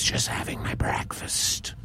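whispered, breathy snake voice; sly snake. Acted. Rattlesnake answering Missus Raven about the eggs, sly whisper. Snake caught at the nest replies.

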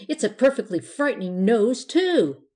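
loud, indignant protest; indignant fury. A narrator's reading.

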